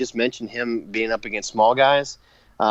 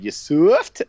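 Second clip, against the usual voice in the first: higher, playful mock catchphrase; Taker catchphrase mimicry; 6 semitones higher, 4 dB louder than usual. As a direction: toss out the wrestler's catchphrase as a joke. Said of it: "if Taker would have said".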